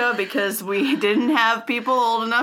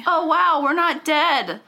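mock surprise; 8 semitones higher, 3 dB louder, slower than usual.

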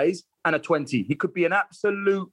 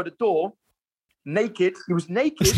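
ordinary talk, nothing marked

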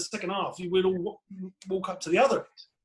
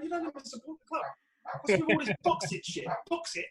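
much higher, incredulous mock telling-off; mock exasperated retort; 9 semitones higher than usual. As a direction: scoff at the fan like he's ridiculous. Retold reply to clueless fan.

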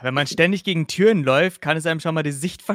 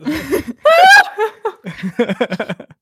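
high laugh; 13 semitones higher, 8 dB louder than usual. Real, not acted. Laughing fit after schnaps remark.

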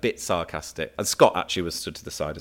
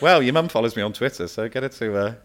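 cheeky retort; 5 semitones higher, 6 dB louder than usual. Retold or imitated. His own cheeky retort retold.

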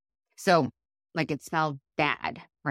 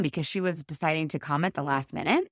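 ordinary talk, nothing marked

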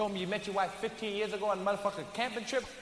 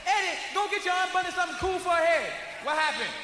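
shouting; 6 semitones higher than usual. Retold or imitated. Husband yells orders to son.

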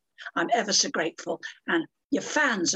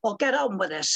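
indignant; 7 semitones higher than usual. Real, not acted. Indignant rant about celebrities.

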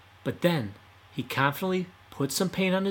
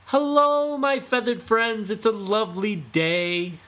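very high, loud sweet-talking call; yelling to ducks; 10 semitones higher, 5 dB louder than usual. Acted. Fox yelling to the ducks, falsely sweet. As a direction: fake-friendly hail across the pond. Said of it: "yelled to the ducks".

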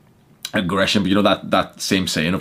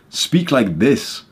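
slow, higher, projected demo; projected, clear voice; 4 semitones higher, much slower than usual. Shown as a demonstration. Coach showing men how to speak up, projected voice. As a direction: project every word with clarity. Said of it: "project your voice. Speak with clarity".

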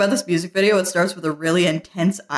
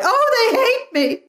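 much higher, slow melodramatic whine; mock wail; slower than usual. Host mocking the heroine running off, in a melodramatic whine. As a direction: ham up her tragic despair. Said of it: "she just dashes off like".

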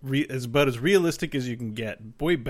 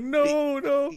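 high squeaky mock no no; mock panic; 13 semitones higher than usual. Player imitating himself panicking as the missile launches. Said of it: "We're just like".

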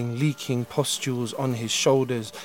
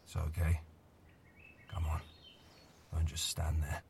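put-on low, soft voice of a gentle old man; a weary old man coaxing a stranger softly; quiet old man; 8 semitones lower, 12 dB quieter, faster than usual. Old man character speaks.

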